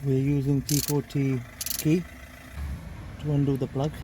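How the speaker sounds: talking while lying on his back; strained voice from under the car. Real, not acted. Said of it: "now that I'm under the car".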